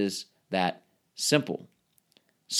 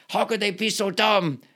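mock exasperation; 6 semitones higher than usual. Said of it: "instead of saying".

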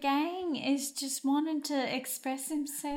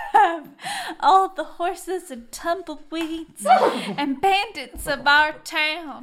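hammy flattery; 7 dB louder than usual. Acted. Captive flatters bandit, breaking up laughing.

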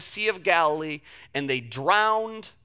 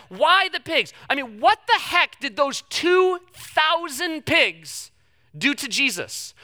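exasperated; 5 semitones higher, 3 dB louder than usual. Acted. Preacher's exasperated questioning.

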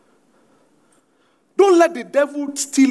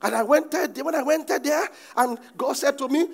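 normal talking voice

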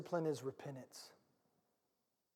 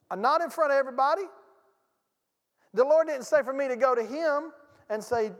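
louder, higher, fast pulpit drive; full-force preaching; 6 semitones higher, 6 dB louder than usual. Acted. Pastor pressing his congregation, full-force preaching. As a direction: push the point hard from the pulpit.

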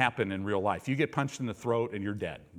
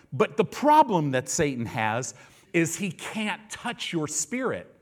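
normal talking voice

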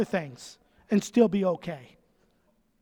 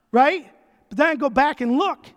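ordinary delivery

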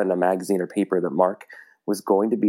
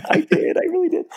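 laughing admission. Real, not acted. Confessing to collectors, laughing.

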